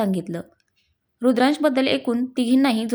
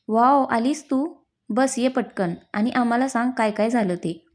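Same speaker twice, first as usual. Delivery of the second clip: ordinary delivery, nothing marked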